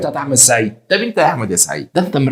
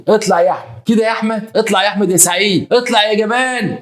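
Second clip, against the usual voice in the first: high-pitched, indignant wife's cry; wronged wife calling out; 9 semitones higher than usual. Retold or imitated. Wife summons her hiding husband from the flat, high indignant call.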